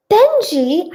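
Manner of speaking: high-pitched call to a pet. A narrator's reading. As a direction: call the cat's name brightly. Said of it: "I call, and he lifts".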